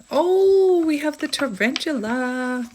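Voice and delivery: high drawn-out oh, then gushing; delighted exclamation. Real, not acted. Card reader greets the tarantula card, delighted.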